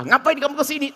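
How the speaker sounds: high, snapping mock-angry question; mock angry debtor. Debtor caught counting money snaps at the lender, mock anger. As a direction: play the caught debtor turning on you. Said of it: "dia malah marah-marah".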